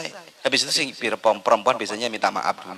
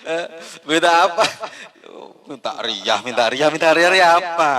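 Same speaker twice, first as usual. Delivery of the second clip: higher, louder demanding tone; gruff husband; 5 semitones higher, 4 dB louder than usual. Retold or imitated. Husband snapping at his apologising wife, higher and louder. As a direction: bark it back, put out and gruff.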